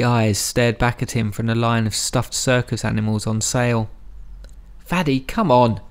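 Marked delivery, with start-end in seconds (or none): 4.9-5.8 prodding. A narrator's reading. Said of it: "prodded Zalmay".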